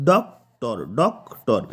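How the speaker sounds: ডক্টর broken as ডক – টর; syllable by syllable. Shown as a demonstration. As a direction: pause between ডক and টর in ডক্টর. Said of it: "তাহলে দুটো syllable হলো".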